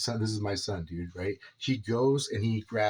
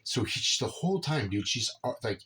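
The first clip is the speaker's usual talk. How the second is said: usual speaking voice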